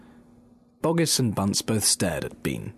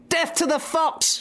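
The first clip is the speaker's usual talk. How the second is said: very high gleeful yell; shrill-high war cry; shouted. Acted. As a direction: cry out for the kill. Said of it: "shouted Bunce".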